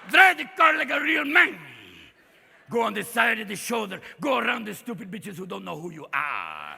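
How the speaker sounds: put-on macho Spanish-accented GPS; swaggering Spanish accent, opening loud; Antonio Banderas. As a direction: give directions as a Latin he-man in Spanish accent. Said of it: "I want Antonio Banderas to tell me".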